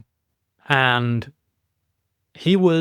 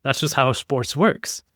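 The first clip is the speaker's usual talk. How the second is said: ordinary delivery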